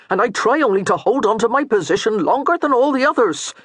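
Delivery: a hint of a patient, harried servant; lightly voiced weary steward. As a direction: plead your case evenly. Steward explaining himself.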